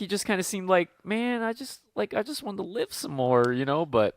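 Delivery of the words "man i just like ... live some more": high, soft, plaintive pilot imitation; mock disappointed. Co-host voicing the pilot who wanted to live, mock disappointed. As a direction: play up quiet regret in a raised voice. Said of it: "he seemed the most disappointed".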